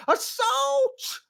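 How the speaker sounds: very high, loud shriek; tangled official shrieking for help; shrieked alarm. Acted. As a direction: shriek from the floor. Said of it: "yelled the skinny individual".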